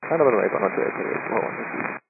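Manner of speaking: air traffic control radio. Real, not acted. Pilot readback over the radio.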